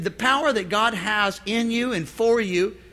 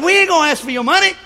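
loud and fast with a higher pitch; bold preacher shout; 8 semitones higher, 7 dB louder, faster than usual. Retold or imitated. Preacher telling the congregation loudly that he won't ask for money. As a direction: shout it out boldly to the crowd. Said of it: "Elijah said".

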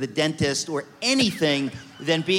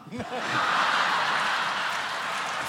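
airy laugh at his own joke; breathy laugh; 7 semitones higher, 5 dB quieter than usual. Real, not acted. Speaker laughing at his own leprechaun story, breathy laugh. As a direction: let out a soft breathy laugh.

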